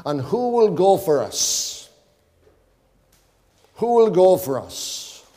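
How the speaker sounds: slow, pleading divine voice; the Lord's slow appeal for who will go. Acted. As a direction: call out slowly as God seeking a messenger. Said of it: "heard the voice of the Lord saying".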